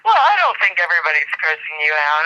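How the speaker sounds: ordinary talk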